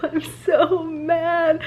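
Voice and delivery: slow, high, half laughing half crying; high tearful laugh; real laughing through tears. Real, not acted. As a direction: laugh helplessly, near tears.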